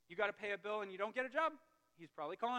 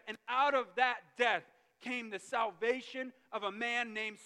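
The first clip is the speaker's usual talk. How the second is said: loud, raised, emphatic sermon voice; fervent preacher in full cry; full-force preaching; 4 semitones higher, 9 dB louder than usual. Real, not acted. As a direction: drive the story home with force.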